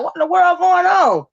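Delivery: high, incredulous what-in-the-world; shocked disbelief. Retold or imitated. Vlogger reacting to a heated panel, shocked and high. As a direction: act stunned at the panel drama. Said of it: "I was like".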